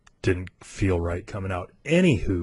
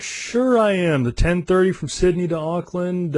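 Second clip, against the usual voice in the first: higher-pitched, drawn-out "sure"; insistent protest; 8 semitones higher than usual. Retold or imitated. Telling the airline he is booked, insistent. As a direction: push back in a high, stretched-out insistence. Disputing airline's word on his booking.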